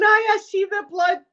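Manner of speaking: high, strained mock agreement; hammed-up queasy high voice; squeamish politeness. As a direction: imitate humouring someone while squirming. Retold squeamish reply to gory pictures.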